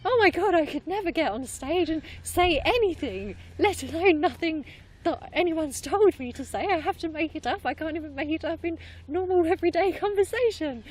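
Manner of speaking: high, loud retold panic; hammed-up anxious outburst, pitched high throughout; mock panic. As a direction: act out an overwhelmed beginner's nerves. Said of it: "came from a place of maybe going".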